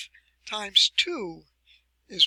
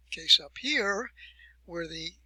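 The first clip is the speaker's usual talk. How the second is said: normal talking voice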